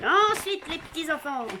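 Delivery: put-on high, loud circus announcer calling the children; voix de cirque. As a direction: play ringmaster to a crowd of little kids. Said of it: "je prends ma voix de cirque". (voix de cirque aiguë, pour les petits enfants)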